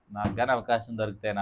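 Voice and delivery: low, soft aside to oneself; secret inner wish. Acted. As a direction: voice a hidden wish under the breath. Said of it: "అంటారు లోపట". (లోలోపల అనుకుంటున్నట్టు, మెల్లగా)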